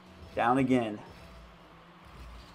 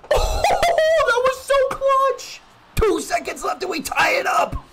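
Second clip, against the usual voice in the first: high laughing shriek of joy; shrieking jackpot winner; 11 dB louder, slower than usual. Real, not acted. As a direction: scream it like you just won. Last-second tying goal.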